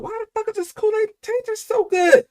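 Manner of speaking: put-on high, squeaky kid voice, wide-eyed; amazed little kid voice. A little boy marvelling at his mom's Kool-Aid in a high squeak. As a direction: play your child self, stunned by the taste. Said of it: "I didn't say it like that, but I was like".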